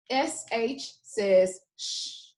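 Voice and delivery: slow, with sh as a long hiss; sh sound. Shown as a demonstration. Teacher modelling the digraph sound slowly. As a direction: hold the sh sound out. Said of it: "Say it with me".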